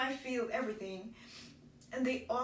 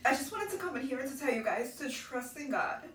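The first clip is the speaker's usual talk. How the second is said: usual speaking voice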